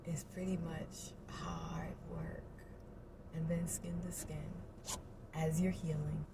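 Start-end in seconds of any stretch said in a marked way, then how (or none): 0.1-2.4 soft, slow whisper; whispered wry understatement. Real, not acted. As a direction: let it drop to a whisper.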